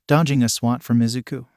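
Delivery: ordinary delivery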